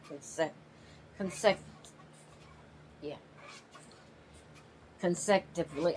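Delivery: stumbling over a word. Real, not acted. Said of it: "I can't say the word".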